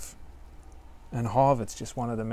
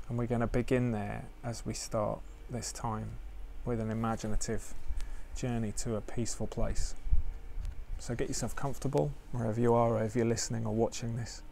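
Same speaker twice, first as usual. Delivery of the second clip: guided meditation; 4 dB quieter than usual. Acted. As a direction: ease listeners into stillness gently.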